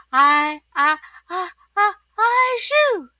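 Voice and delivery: climbing ah-ah build-up to achoo; high, loud storybook sneeze; acted sneeze. A narrator's reading. SpongeBob sneezes at story's end.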